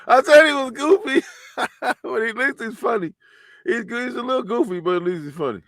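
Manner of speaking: high-pitched, cracking up while talking; real giggly aside; laughing amusement. Real, not acted. Host cracking up at the clip.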